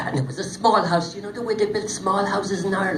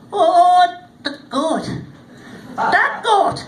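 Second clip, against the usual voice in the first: high, loud exclaiming little man; little man's voice; 12 semitones higher, 8 dB louder, much slower than usual. Acted. Little man revealing the goat's secret, high and loud. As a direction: crow it out with glee.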